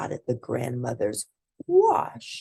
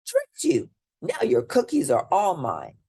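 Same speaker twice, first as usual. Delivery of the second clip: gleeful cry; 6 semitones higher, 4 dB louder than usual. A narrator's reading. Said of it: "cried Big Bad Pete".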